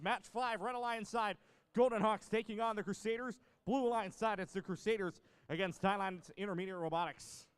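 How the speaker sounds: loud, high arena announcer call. Real, not acted. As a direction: project it across the arena. Match announcer calls teams.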